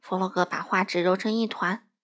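plain reading voice

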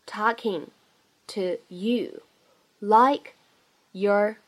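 each word apart with a pause. Shown as a demonstration. Teacher models the sentence for learners, word by word. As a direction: dictate it slowly, one isolated word at a time.

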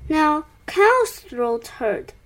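ordinary delivery, nothing marked

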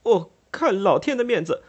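a cornered man trying to escape, words tumbling; awkward brother begging to leave, high and rushed; flustered, embarrassed. Acted. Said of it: "越发觉得狼狈不堪，说道".

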